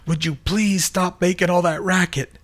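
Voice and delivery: high, loud annoyed holler; groggy friend hollering to stop the noise. Acted. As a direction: holler it out irritated. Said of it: "Diane hollered out".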